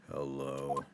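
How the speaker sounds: gloomy detective. Acted. Gloomy Sholmes greeting.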